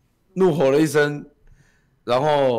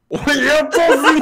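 high, loud shouted comeback; hammed-up challenge; mock threat; 11 semitones higher, 5 dB louder than usual. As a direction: shout it right back at her. Rival shouting back threat.

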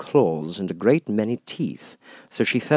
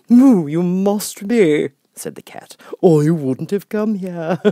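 grinning Cheshire Cat; 10 semitones higher, 8 dB louder, slower than usual. Acted.

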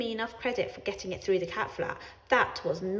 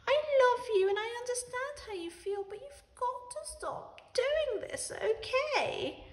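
Ellie pleading. A narrator's reading. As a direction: coax the cat gently, up high. Ellie holds cat's paws.

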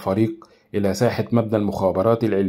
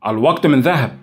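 louder, higher push to hurry; impatient order; 5 semitones higher, 6 dB louder than usual. Acted. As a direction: snap him into action. Minister hurrying his assistant.

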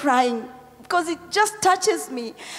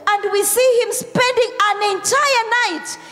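full-force preaching; 5 semitones higher than usual. Real, not acted. Pastor to congregation on Jesus praying all night, forceful preaching. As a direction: preach it out, stretching the key words.

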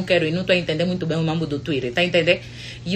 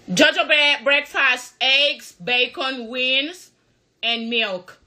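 sweet breakfast call; 5 semitones higher, much slower than usual. Pretend. Pretend partner listing breakfast.

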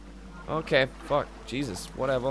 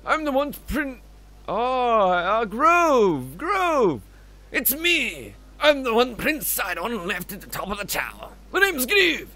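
put-on high, swooping Scottish accent; Scottish-accented Groove; 8 semitones higher, 7 dB louder, much slower than usual. Groove introducing himself to the hero, excitable Scottish accent. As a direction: greet eagerly in a put-on Scottish lilt. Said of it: "Don't know why you went Scottish".

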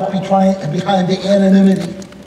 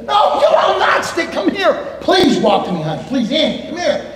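higher, pleading and calling out; 8 semitones higher than usual. Retold or imitated. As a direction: coax her over from far off, half laughing. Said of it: "I go".

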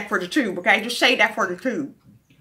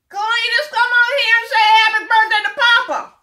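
loud, high call across the house; 8 semitones higher, 7 dB louder than usual. Pretend. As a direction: yell for Cletus to come in. Calling Cletus into the room.

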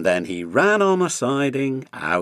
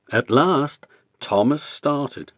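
ordinary delivery, nothing marked

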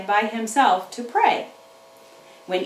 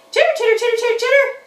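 high, chirpy critter squeak; squeaky puppet chatter; 11 semitones higher, 4 dB louder, much faster than usual. Pretend. Puppet chatters at the children, high and squeaky. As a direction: chatter like a small excited puppet animal.

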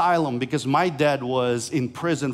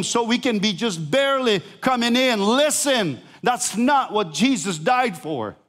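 preaching at full force; 8 semitones higher than usual. Acted. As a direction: preach it out, building to a climax.

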